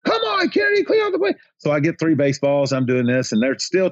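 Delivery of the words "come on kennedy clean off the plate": high, strained heckler yell; mock scream, pitched way up; imitated fan screaming. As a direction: imitate angry fans screaming from the stands. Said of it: "start screaming at me again".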